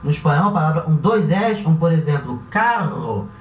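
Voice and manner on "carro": carro com RR vibrante e forte; faça o RR de carro vibrar; rolled double R. Shown as a demonstration. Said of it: "Carro também vai ter esse som vibrante". (RR vibrante em carro)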